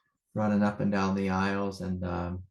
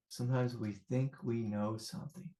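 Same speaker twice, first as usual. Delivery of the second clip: soft reflective repeat; 8 dB quieter than usual. Real, not acted. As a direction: let "think we know something" fall to a soft, gentle voice. Repeats the line softly.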